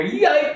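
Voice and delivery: high yelp of a scared dog; imitated dog yelp. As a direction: yip like a frightened little dog. Dogs yelp and hide.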